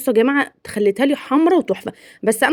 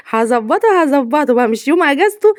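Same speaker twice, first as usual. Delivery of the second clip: said through real laughter, playful; amused; 3 semitones higher than usual. Real, not acted. Wife joking about treating her husband on his day off, amused. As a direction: giggle while insisting.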